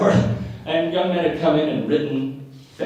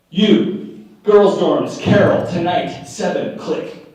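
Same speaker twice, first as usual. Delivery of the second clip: staccato orders with pauses between words; imitating a curt bishop, word by word; verbless bishop; 3 dB louder, much slower than usual. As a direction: bark it out like a terse bishop on the phone. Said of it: "believes in the use of verbs".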